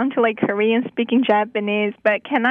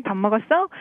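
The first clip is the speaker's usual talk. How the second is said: Seoul accent; much faster than usual. Shown as a demonstration. Busan local giving Seoul speech a try, Seoul accent. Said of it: "let me give it a try".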